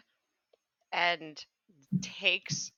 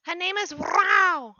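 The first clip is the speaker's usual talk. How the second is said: sweet character voice; 8 semitones higher, 9 dB louder than usual. Acted. Player's character voice.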